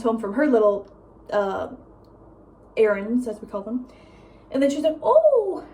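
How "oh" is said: high, loud, drawn-out mock surprise; stretched high mock-innocent "oh"; mom's prying "oh". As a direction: imitate mom with a high-pitched "oh". Mimics mom asking about class.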